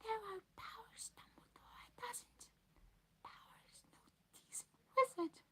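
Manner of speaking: high whispered elf voice; urgent whisper. Acted. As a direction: breathe it like a secret. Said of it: "dropped to an urgent whisper".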